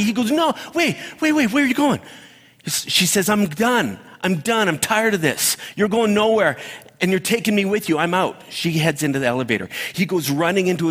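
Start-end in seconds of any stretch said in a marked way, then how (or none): 0.3-2.0 high-pitched frantic pleading, played up; mock panic. Boyfriend stopping his girlfriend leaving, mock panic. 3.3-8.4 higher-pitched exasperated girlfriend, lightly acted; mock fed-up. Girlfriend walking out on Happy, mock exasperation.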